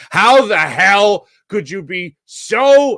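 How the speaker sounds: drawn-out, disbelieving How the hell; exasperated disbelief. Retold or imitated. Venting to listeners about the manager, slow exasperated disbelief. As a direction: stretch every word out in exasperation.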